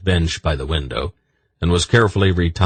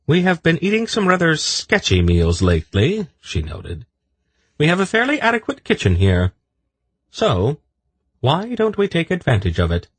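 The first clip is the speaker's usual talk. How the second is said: much higher, composed voice; high lady's voice; 12 semitones higher than usual. A narrator's reading. Polgara suggesting a proper meal, high composed voice. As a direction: propose it calmly and brightly.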